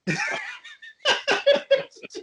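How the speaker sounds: high-pitched burst of laughter. Real, not acted.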